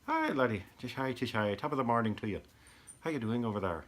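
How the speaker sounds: cheery mock Irish greeting, only lightly put on; playful hint of an Irish lilt; Irish accent. Shown as a demonstration. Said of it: "I can do Irish pretty well".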